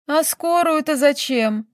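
high, slow, grieving question; sorrowful outburst. A narrator's reading. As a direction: let it burst out in sorrow. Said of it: "горестно вырвалось у графини". (горестно)

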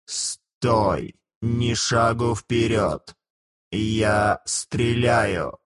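inhuman metallic guard, drawn out slowly. Acted. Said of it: "странный металлический голос, очень не похожий на голос живого человека".

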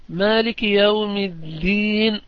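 مالك said with a long a after the m, slow and chanted; Maalik with long a. Shown as a demonstration. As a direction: draw out the alif in مالك. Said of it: "بإثبات ألف بعد الميم".

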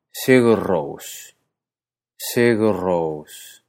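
Sigur Rós very slow, sheh-gheh rous; Icelandic Sigur Rós. Shown as a demonstration. Teacher models the full band name in Icelandic. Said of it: "el grupo se llama entonces".

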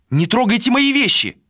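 high, alarmed woman's outcry; indignant protest. Acted. Malika to Vilar packing her things, indignant.

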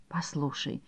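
soft whisper. A narrator's reading. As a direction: whisper it gently. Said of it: "прошептал Ангель".

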